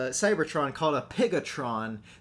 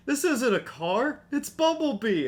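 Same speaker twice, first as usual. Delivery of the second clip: higher, insistent imitated Spike; mock-earnest, slower start; Spike quote; 11 semitones higher, 3 dB louder than usual. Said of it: "interaction between Spike and the cop".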